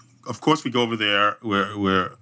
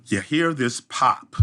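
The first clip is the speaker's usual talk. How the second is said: usual speaking voice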